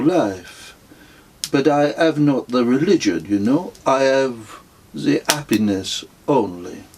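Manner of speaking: a gentle, contented guru explaining his plans; a hint of a placid holy man; Indian mystic voice. A narrator's reading.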